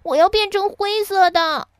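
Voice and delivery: high, loud demand. A narrator's reading. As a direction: cry out insistently in Hawar's voice. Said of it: "哈瓦尔叫道". (高声喊着要求)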